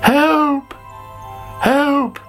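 high-pitched storybook cry for help; calling for help. A narrator's reading. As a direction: call out in alarm, voice pitched right up. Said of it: "called Sammy".

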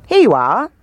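a hint of a dismissive mayor.

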